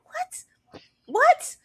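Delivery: high shocked repeats; shocked disbelief. Retold or imitated. Reacting to hot oil, high shocked what. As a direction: squeak it out in disbelief. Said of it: "I was like".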